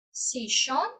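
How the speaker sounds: "sillón" with the ll as sh, like "shon"; sh sound. Shown as a demonstration. Teacher showing students the sh way. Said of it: "Com sonido de chá".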